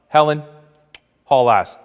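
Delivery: loud, punchy command to a passenger; acted no-nonsense bus driver order; brusque order. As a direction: call it out sharply down the bus.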